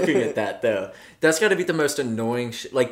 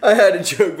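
ordinary delivery

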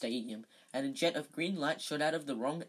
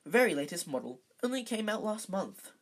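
usual speaking voice

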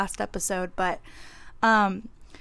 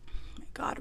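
whispered; 11 dB quieter, much faster than usual. Real, not acted. Aside about wild relationships.

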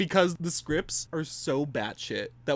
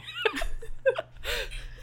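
high squealing laugh; 4 dB quieter than usual. Real, not acted. Cracking up at Cats joke.

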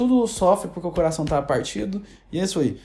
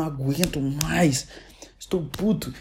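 whispered, slow, as a mock complaint; 4 semitones lower, 5 dB quieter, slower than usual. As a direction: hiss it like a sulky confession. Mocking Felipe Neto's complaint. (sussurro debochado)

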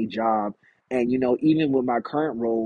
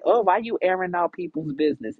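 higher, put-on scolding grandma voice; imitating grandma; 7 semitones higher than usual. Grandma scolding him for airing family business, higher put-on voice. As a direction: play grandma fussing at him. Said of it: "talk to me and say".

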